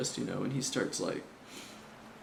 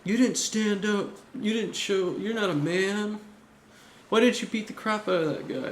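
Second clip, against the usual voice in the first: Luffy yelling at Shanks in anger; pissed-off kid; 8 semitones higher, 4 dB louder than usual. Retold or imitated. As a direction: scold him like a furious child. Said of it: "like, pissed".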